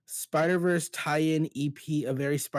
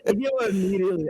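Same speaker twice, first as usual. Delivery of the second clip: put-on fast, higher, a botched Jersey accent; failed Jersey accent; 5 semitones higher, 4 dB louder, much faster than usual. Co-host admitting defeat, a fast botched Jersey accent. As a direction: rush a clumsy Jersey accent, then give up. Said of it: "I can't do an accent".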